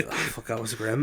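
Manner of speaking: usual speaking voice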